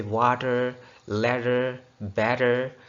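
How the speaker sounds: "वाटर, लैडर, बैटर" with the middle consonant tapped like r; American flap in "वाटर, लैडर, बैटर". Shown as a demonstration. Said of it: "वो वाले रे की आवाज़ देंगे".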